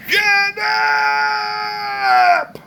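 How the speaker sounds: drawn-out, high-pitched shout; full-force, stretched-out screech for silence; yelling Sister Mary Elephant. Acted. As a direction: yell it long and high to silence a room. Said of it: "Sister Mary Elephant would yell".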